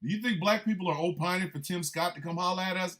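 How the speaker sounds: ordinary talk, nothing marked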